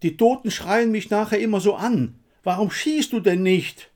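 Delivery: high, loud agitated shouting; roared, exasperated demand; frantic bellowing. A narrator's reading. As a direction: yell desperately at your comrade under fire. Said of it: "brüllte der Blasse".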